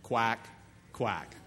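low mocking quack, quack; duck quacks. Preacher voicing the devil as a duck, low mocking quack.

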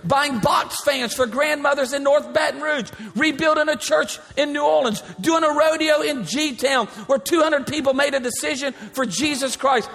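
high, ringing preacher's build to the crowd; full-force preaching. Acted. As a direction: preach it out, rising and driving.

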